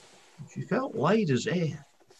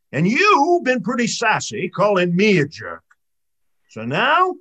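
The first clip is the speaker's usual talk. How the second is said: put-on mad old man of the sea; 6 semitones higher, 7 dB louder than usual. A narrator's reading. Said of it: "muttering in his whiskers and madder".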